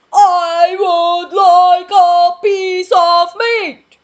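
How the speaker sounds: very loud, high, slow sung request; booming giant voice. Acted. Mister Noisy booming his order at the butcher. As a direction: boom it out, dragging every word. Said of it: "said in his voice".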